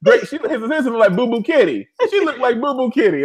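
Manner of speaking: high, laughing insistence; amused blurting. Real, not acted. Host tells co-host about a lookalike, laughing.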